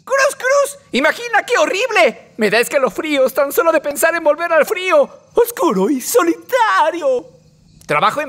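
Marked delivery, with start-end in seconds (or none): 0.0-2.3 mock horror. Dreading return to space.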